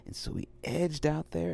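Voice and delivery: ordinary delivery, nothing marked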